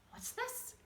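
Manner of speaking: high, whispered surprise; surprised whisper. Acted. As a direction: breathe it in wonder. Grunt startled by smiling.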